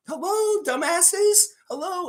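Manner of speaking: high sing-song taunt; high, mocking hello to the dumbasses. Real, not acted. Mocking rival journalists.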